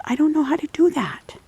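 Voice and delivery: high whispered little dog voice; timid dog voice. Pretend. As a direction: whimper it like a scared puppy. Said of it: "He goes".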